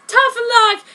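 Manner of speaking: high, loud taunt. A narrator's reading. Kishu brushes off the threat, taunting. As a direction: toss it back mockingly loud. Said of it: "he said".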